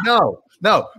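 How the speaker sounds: ordinary talk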